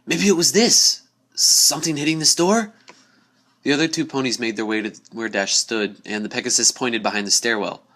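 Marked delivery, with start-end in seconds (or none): 0.1-2.7 higher and louder, eager; excited call. A narrator's reading. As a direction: call out the find from the stairs. Dash calls out discovery.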